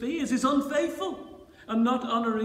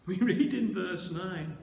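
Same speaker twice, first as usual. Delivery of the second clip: ordinary talk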